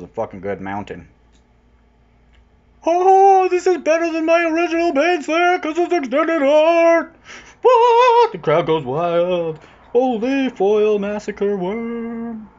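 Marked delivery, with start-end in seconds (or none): 2.8-8.3 very high, very loud shriek; ecstatic screaming. Acted. Finding a better Baneslayer, shrieking with delight to viewers. 8.3-12.5 slow, loud hype call; mock announcer. Hyping the foil worm to viewers like an announcer, slow and loud.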